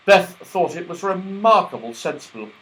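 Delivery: usual speaking voice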